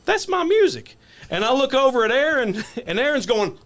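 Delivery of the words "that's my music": high, excited realization; high-pitched shock of recognition; sudden realization. Retold or imitated. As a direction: blurt it out as it dawns on you. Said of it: "And then finally I'm like".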